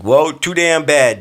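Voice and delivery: loud, high, drawn-out comic dismissal; mock defiance. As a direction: brush her off in playful outrage. Mock telling off of singer.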